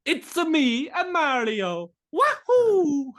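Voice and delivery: very high, loud Italian Mario voice, laughing; cartoon Italian plumber, big wahoo. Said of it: "what do you propose?".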